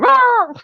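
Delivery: high, loud roar; mock dog roar. The dog lunging at the officer, a loud high roar.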